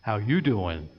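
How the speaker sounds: second, better take of the Joey greeting; Joey Tribbiani. Retold or imitated. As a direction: lean into you like a smooth sitcom flirt. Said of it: "Let me try that one more time".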